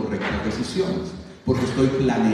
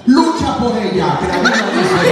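much higher, louder rallying cry; fired-up urging; 9 semitones higher, 7 dB louder than usual. Acted. Preacher as a friend cheering a heartbroken buddy, loud and high. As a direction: push him to go fight for her.

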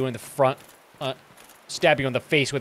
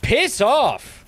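high, loud, exasperated yell; 8 semitones higher, 4 dB louder than usual. Real, not acted. Player fed up with a persistent enemy, loud high yell. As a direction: yell it at the pest who won't quit.

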